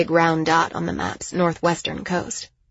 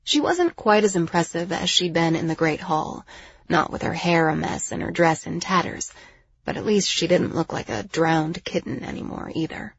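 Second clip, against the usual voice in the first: usual speaking voice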